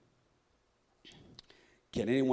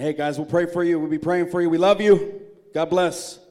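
loud, high farewell call; calling back; 7 semitones higher, 14 dB louder than usual. Retold or imitated. Departing men calling back to Gideon's army, loud. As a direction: shout goodbyes over your shoulder.

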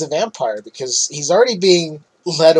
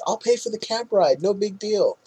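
pleading imitation; 6 semitones higher, 3 dB quieter than usual.

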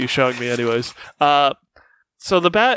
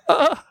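sharp high yelp; startled yelp; 12 semitones higher than usual. Acted. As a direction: yelp as the ball smacks. Leo hit by flying ball.

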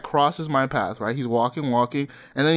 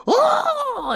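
crowd roar. Retold or imitated. Mimicking the crowd as [name] is announced, high yell. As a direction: belt it like the crowd going wild.

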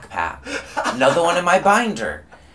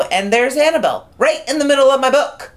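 raised, louder retelling of a shock; exclaimed shock; 10 semitones higher, 6 dB louder than usual. Retold or imitated. Telling of the poster found in the binder, exclaimed shock. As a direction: build to an indignant high point.

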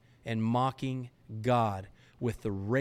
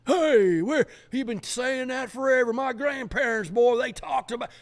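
much higher and louder sneering voice; mocking scoffer; 12 semitones higher, 7 dB louder than usual. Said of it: "you hear these prideful, arrogant suckers say that".

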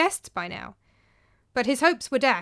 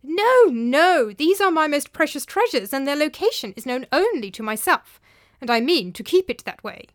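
loud, raised, insistent refusal; Theopropides, adamant old master; 4 semitones higher, 6 dB louder than usual. Acted. Master refuses servant's help with treasures, adamant. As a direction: put your foot down firmly.

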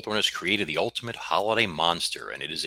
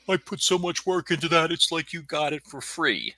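high mock complaining voice; complaining taxidermist; 12 semitones higher, 3 dB louder than usual. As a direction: whine it like a put-upon craftsman. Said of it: "of course he complained".

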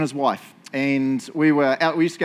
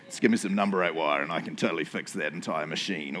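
much lower, softer put-on voice of a know-it-all Kiwi; low mock Kiwi bloke; 10 semitones lower, 7 dB quieter than usual. Preacher mimicking a typical New Zealander, low mock bravado. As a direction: drop low and puff up the can-do swagger.

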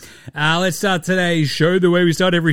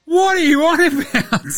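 incredulous rant; 7 semitones higher, 3 dB louder than usual. Real, not acted. Host ranting at Harden.

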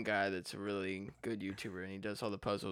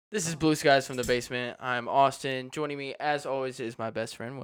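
louder, upbeat show opening; podcast host intro; 3 semitones higher, 7 dB louder than usual. Acted.

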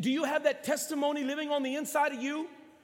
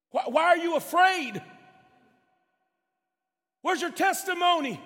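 loud, driving preacher's delivery; forceful preaching; 3 semitones higher, 4 dB louder than usual. Real, not acted. Preacher urging the congregation to trust, forceful preaching. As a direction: preach it hard and urgently.

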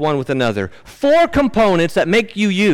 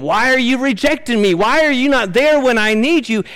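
raised, pleading paraphrase of a lament; voicing the psalmist; 7 semitones higher than usual. Preacher voicing the psalmist's cry for the congregation, lightly pleading. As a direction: lightly voice a desperate cry to God.

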